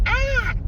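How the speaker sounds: high imitated yelp of a frightened cat; short, high-pitched mock shriek; Pumpkin. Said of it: "Pumpkin just goes".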